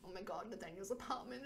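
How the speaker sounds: plain reading voice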